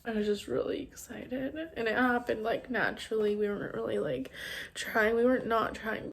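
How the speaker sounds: fighting back tears. Real, not acted. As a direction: get through it quietly while close to crying. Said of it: "I'm trying not to cry right now".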